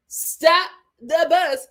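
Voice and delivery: slow emphatic echo. Real, not acted. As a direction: repeat it, drawn out and punchy. Repeated hype.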